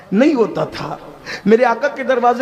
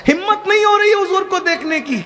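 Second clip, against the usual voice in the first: high, wailing plea held on one pitch; acted anguished plea; 12 semitones higher than usual. Weeping man confesses he dares not face the Prophet, high anguished plea. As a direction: cry it out high, as if overwhelmed.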